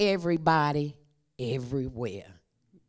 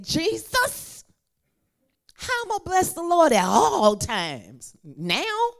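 high, loud plea; pleading prayer to Jesus; 10 semitones higher, 7 dB louder than usual. Retold or imitated. Preacher retelling her desperate question to Jesus, loud and high. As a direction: cry out to Jesus in disbelief. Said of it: "So I said".

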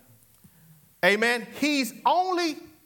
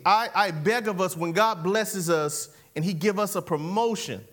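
ordinary talk

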